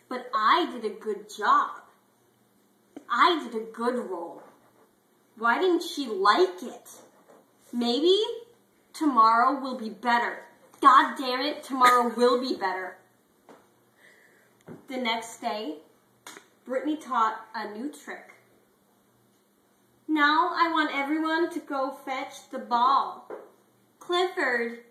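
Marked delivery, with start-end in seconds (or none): 0.1-13.0 louder and louder, wounded and fed up; frustrated rant. Acted. Hurt dog rants to himself. 20.1-24.8 loud, higher teacher call across the yard. Acted. Teacher calls the class loudly.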